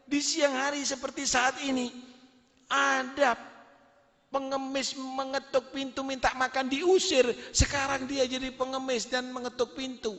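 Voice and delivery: high, tearful wailing; crying wife. Acted. Wife tells husband through tears about her ex-husband, wailing. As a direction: sob it out in disbelief. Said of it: "Nangis ini istrinya".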